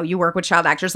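ordinary talk, nothing marked

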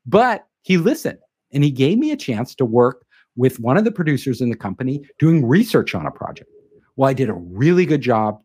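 ordinary talk, nothing marked

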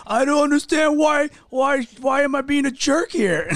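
high, loud hammed-up crying coworker; mock Oscar-worthy crying. Reenacting the weepy coworker for his wife, hammed-up and high. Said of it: "an Oscar performance".

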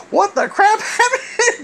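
high, slow, laughing through the words; laughing disbelief. Retold or imitated. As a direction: ask it cracking up in disbelief. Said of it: "and he said".

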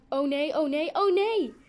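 high, loud cries climbing in panic; crying out. A narrator's reading. Isabel trying the exit door, crying out. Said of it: "tot ze riep".

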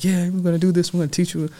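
hyped-up guru. Retold or imitated. Real estate guru hyping up his paying students, excited. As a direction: gas the room up like a seminar guru. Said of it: "these gurus, and they're trying to ... gas you up".

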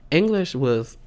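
normal talking voice

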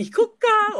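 loud high lilting "行こっか"; high sing-song. Retold or imitated. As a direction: belt it high and playful. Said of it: "三回も言ってる".

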